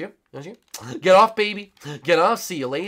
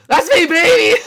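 excited; 11 dB louder than usual. Real, not acted. Gloating reveal in game.

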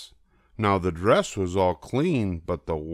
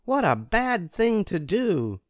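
put-on much higher child voice scolding the cat; 12 semitones higher than usual. A narrator's reading. Narrator scolding the cat for eating cake, in a high child voice. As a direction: scold him in a high little voice. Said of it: "I said to the cat".

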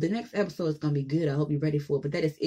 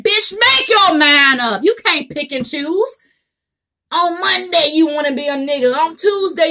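higher, louder ranting tirade; loud scolding sermon to the viewers; 8 semitones higher, 10 dB louder than usual. Real, not acted. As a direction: shout the sermon at them. Ranting at women who want manhood.

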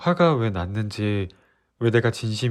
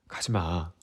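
soft plea; 6 semitones lower, 8 dB quieter, slower than usual. Acted. Begging her not to leave.

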